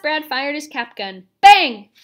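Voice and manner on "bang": sudden, very loud burst; exclaimed bang. A narrator's reading. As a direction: fire the word out like a cap gun. Narrator sounds the cap gun.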